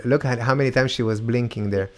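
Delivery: usual speaking voice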